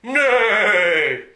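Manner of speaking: loud, high whinnying "Nay!"; the horse Dodger whinnies in protest; horse neigh. Acted.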